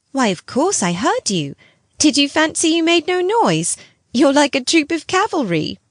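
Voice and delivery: put-on playful boy. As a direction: tease her like a cheeky child. The boy Miles teasing.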